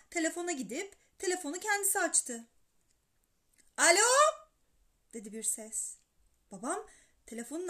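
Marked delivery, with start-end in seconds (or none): 3.8-4.1 loud, higher phone hello; a boy yelling into the receiver; shouting. A narrator's reading. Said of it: "telefonda bağıranın Alcest olduğunu".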